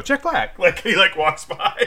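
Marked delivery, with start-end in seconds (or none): 0.0-0.6 much higher, delighted recognition; pleased spot-the-actor call; mock recognition. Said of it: "where you go".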